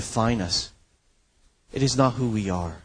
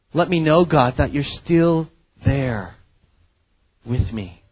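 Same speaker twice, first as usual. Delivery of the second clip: mild pleading cry; 4 semitones higher, 5 dB louder than usual. Acted. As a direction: beg God to stay near. Pleading psalm quote.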